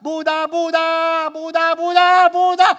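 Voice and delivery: high, loud repeated chant-like cries; frantic high invocation; falling shouts. Acted. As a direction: keep yelling for rescue as you fall. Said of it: "Sumigaw siya".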